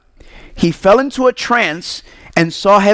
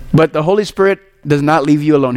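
usual speaking voice

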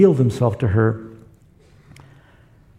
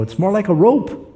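blind man; 8 semitones higher than usual. Retold or imitated.

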